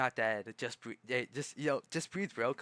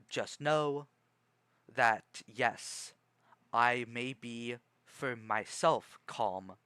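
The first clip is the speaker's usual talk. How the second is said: calm clear speech; slower than usual. Shown as a demonstration. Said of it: "but I try to say clear, calm".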